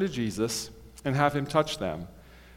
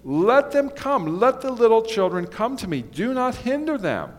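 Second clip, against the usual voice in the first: higher, insistent plea; 7 semitones higher than usual. Retold or imitated. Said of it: "he goes on to say".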